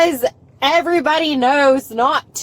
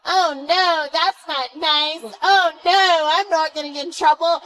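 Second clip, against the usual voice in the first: exaggerated fake dismay, sing-song; sarcastic put-on "oh no" voice; mocking mimicry. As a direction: taunt with repeated mock concern. Mockingly parrots the officer's dismay.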